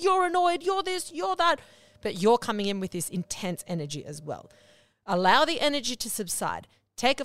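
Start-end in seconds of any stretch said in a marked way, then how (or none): 0.0-1.6 high, sharp mocking accusation; mock accusing partner. Mimicking a partner snapping accusations during a fight. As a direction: throw the blame back in a shrill put-on voice.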